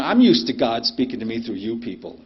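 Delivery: normal talking voice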